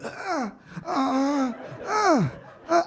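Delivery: high, soft mock groans of a pregnant wife; pained moaning. Heavily pregnant wife groaning, high soft mock moans. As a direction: moan weakly like she's in pain. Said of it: "she just makes sounds like".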